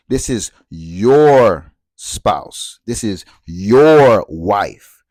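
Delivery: slow, loud, weighty stress on "your" in "This is your spouse"; slow heavy stress on your. Shown as a demonstration. Telling the audience what monogamy says, slow and weighty. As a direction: lean hard on "your", drawn out. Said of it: "when I say it like that".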